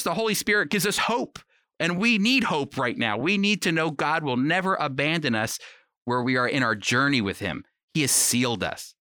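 usual speaking voice

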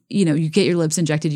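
ordinary talk

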